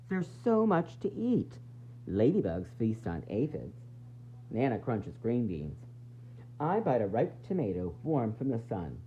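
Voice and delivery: plain reading voice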